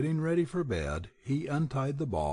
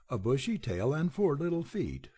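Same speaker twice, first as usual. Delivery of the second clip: plain reading voice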